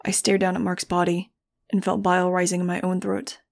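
ordinary delivery

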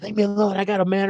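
very high mocking woman's voice; S0 mimics the woman at the light, very high. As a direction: mimic her brushing him off, high. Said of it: "she's like".

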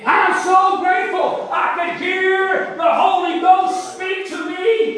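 high, exultant preacher's shout; full-force preaching. Real, not acted. Preacher thanking God before the church, exultant shout. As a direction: cry out thanks to the congregation.

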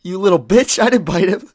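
whiny lion. Acted. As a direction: whine like a hurt lion. Lion whining after slap.

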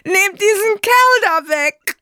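high, loud crying shout; desperate scream. Acted. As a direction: scream it out in despair. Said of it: "schrie er voll Verzweiflung".

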